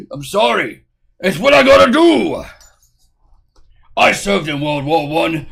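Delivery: put-on boastful safari man accent; grandly spun war tall tales in a safari man voice; Bugs Baron. Streamer bragging of war exploits, pompous safari man. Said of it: "a safari man ass accent".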